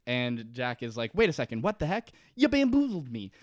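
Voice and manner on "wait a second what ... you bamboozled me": high-pitched mock indignation; comically affronted squeak; indignant shock. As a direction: play a bamboozled man, voice climbing high. Acts Jack shocked at being locked out.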